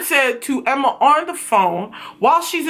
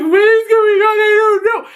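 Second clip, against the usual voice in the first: panicked confusion; 7 semitones higher, 4 dB louder than usual. Retold or imitated. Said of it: "And she's like".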